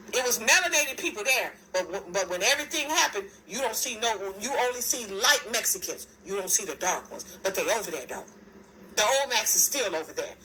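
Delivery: ordinary talk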